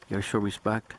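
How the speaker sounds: usual speaking voice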